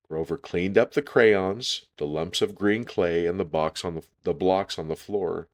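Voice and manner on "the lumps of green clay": plain reading voice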